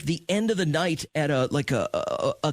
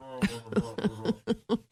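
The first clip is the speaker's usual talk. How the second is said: soft, higher-pitched imitated gibberish; muffled mock mumbling; Snoopy's teacher mumble; 7 semitones higher, 5 dB quieter than usual. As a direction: mumble wordlessly as if facing away. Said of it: "Like Snoopy's teacher".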